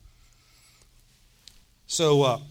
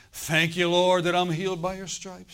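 grateful praise; 5 semitones higher, 6 dB louder than usual. Retold or imitated. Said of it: "I'd say".

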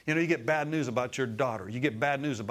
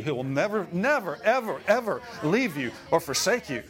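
rising louder and higher on never, ever; building emphasis; 4 semitones higher, 4 dB louder than usual. Acted. Preaching climax on never ever.

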